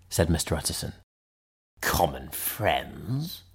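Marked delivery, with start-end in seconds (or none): 1.8-3.4 low, slow, hoarse echo; a touch of hoarseness, softer and drawn out; a little hoarse. A narrator's reading. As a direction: repeat it slowly with a hoarse catch. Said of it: "a little hoarsely".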